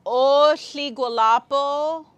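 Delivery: loud, high and slow call to the crowd; greeting a gathered crowd, called out loud. Shown as a demonstration. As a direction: call the greeting out to the whole room. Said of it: "calling out greeting".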